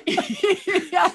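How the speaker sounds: bursts of high, loud laughter. Real, not acted. Wife laughing at husband's proof, loud and high.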